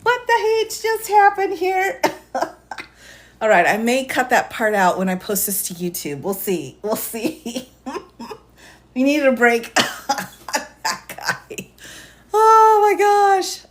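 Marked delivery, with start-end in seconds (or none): 0.0-2.0 mock baffled outburst. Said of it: "I'm like". 12.3-13.6 slow, high oh my gosh; high drawn-out gasp. Real, not acted. Host reacting to the chaos, stretched high gasp. Exclaims after the interruption.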